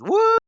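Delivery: high, loud "woo"; whooping cheer. Retold or imitated. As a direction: imitate a thrilled rider's whoop. Imitates rider cheering.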